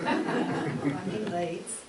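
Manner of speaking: loud, hammed-up Yorkshire accent played for a laugh. Shown as a demonstration. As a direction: drag out "I'm in Leeds" in broad Yorkshire through a laugh. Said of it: "this is my Yorkshire accent".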